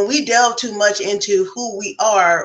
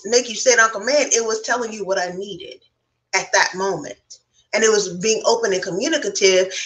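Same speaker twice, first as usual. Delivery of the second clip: ordinary delivery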